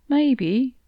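tentative, left hanging; trailing off. A narrator's reading. Cat offering advice to upset companion, trailing off. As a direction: let the suggestion trail away unfinished. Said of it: "his voice trailed off".

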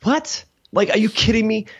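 high, shocked what, then are you kidding me; stunned disbelief. Retold or imitated. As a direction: gasp at unexpected good news. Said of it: "I'm like".

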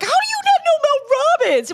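how could anyone not know her; squeaky high disbelief; incredulous. Retold or imitated. Retold incredulous reaction.

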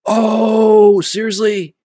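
high, loud disbelief; real surprised outburst; astonished. Real, not acted. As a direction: react loudly, caught completely off guard. Amazed at learning the actor's role.

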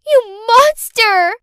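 shouted accusation, slow and loud. Acted. Girl yells at her stepmother in a slow, loud shout. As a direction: hurl the words at her, slowed and loud.

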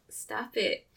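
soft, half-hearted telling-off; feeble Biden retelling. Retold or imitated. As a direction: say it quietly, with no force behind it. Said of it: "with Biden, he was like".

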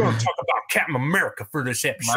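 put-on fast, broad Southern accent; heavy Southern accent. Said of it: "gave myself a real heavy Southern accent".

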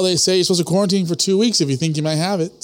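ordinary talk, nothing marked

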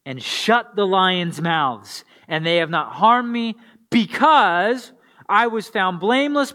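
measured, earnest Bible reading; scripture reading. Acted. Pastor reading Daniel to his congregation, earnest. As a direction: read the passage like it matters.

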